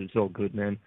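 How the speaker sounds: ordinary talk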